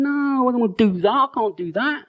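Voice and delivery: high-pitched mock whine of refusal; reluctant protest. Acts out the reluctant flesh.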